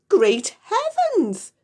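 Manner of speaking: very high, loud, slow exclamation; crying out. Acted. Mister Cranky crying out at the giant hen, very high and slow. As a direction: throw it out in wild alarm. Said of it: "he cried".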